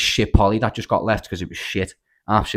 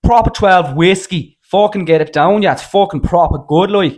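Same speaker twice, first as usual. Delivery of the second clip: higher, louder, slow cocky Irish whiskey pitch; McGregor Irish accent; 8 semitones higher, 8 dB louder, slower than usual. Pretend. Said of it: "I can't help the accents".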